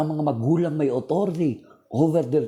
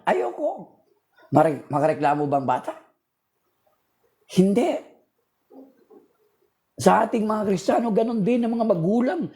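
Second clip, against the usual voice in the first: fiery preaching; 7 semitones higher than usual. Acted. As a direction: preach it out to the whole church. Preaching to the congregation.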